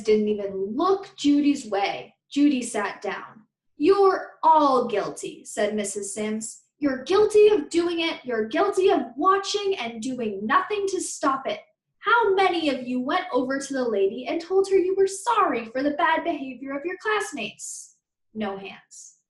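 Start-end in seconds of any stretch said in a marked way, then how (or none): 3.8-5.4 slow, loud, stern accusation; teacher's slow, loud verdict; stern scolding. Acted. Teacher scolding whole class. 6.8-18.0 stern scolding. Acted. Teacher scolding whole class.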